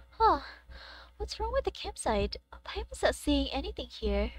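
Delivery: soft, quiet game-dialogue read; quiet voiced-over dialogue read; reading game lines. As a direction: read the characters' lines softly.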